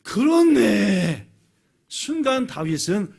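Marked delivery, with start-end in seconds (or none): 0.0-1.3 loud, drawn-out exclamation; sudden realisation. Acted. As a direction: draw it out as the truth dawns. David suddenly realises God was there. (크고 길게 깨달음을 외치듯)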